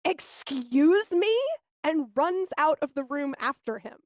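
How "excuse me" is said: indignant nurse. Retold or imitated. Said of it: "The nurse goes".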